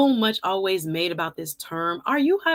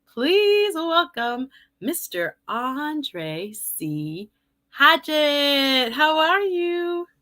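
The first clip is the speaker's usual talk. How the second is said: drawn-out guest introduction, rising higher; show host welcome; 6 semitones higher, much slower than usual. Real, not acted. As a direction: announce the guest with a big, stretched-out welcome.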